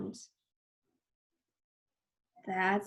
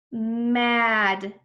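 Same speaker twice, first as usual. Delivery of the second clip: drawn-out blending; 4 dB louder than usual. Shown as a demonstration. Teacher blends letter sounds, Mad drawn out. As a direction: stretch every sound of Mad.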